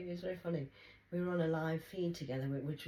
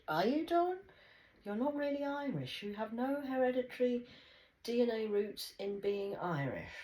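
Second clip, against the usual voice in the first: much higher, louder mocking challenge; sarcastic retort; 10 semitones higher, 6 dB louder than usual. Taunting Don about not really being Irish, high. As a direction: needle him in a high taunting voice.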